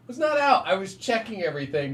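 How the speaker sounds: high, indignant insistence; defensive protest. Retold or imitated. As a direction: protest that you were fine. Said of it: "I'm like".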